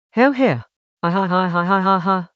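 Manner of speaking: gloating villain cackle; a smug evil queen's laugh. Acted.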